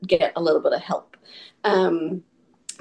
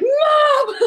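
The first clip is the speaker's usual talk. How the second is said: put-on high, loud child's wail; shrieking little boy; kid's outraged shout; 6 dB louder than usual. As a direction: yell it like a panicked kid. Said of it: "he's like".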